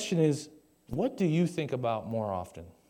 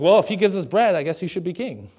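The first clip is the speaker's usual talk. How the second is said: loud, higher-pitched imitation of a simple-minded crowd; mock crowd reasoning; 4 semitones higher, 7 dB louder than usual. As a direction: voice the crowd's easy logic, hammed-up. Acting out the crowd's shallow reasoning.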